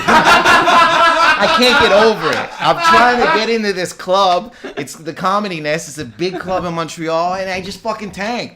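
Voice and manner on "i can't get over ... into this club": laughing wail. Real, not acted. Wailing about bombing a set.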